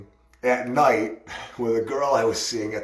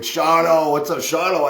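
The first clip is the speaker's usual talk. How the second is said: loud, high friendly shout of the name; calling out a greeting; 5 semitones higher, 5 dB louder than usual. Retold or imitated. Friend greeting Shawno loudly across the room, calling out. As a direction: hail him across the room.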